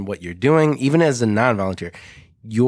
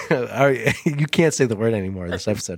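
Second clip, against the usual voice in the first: ordinary delivery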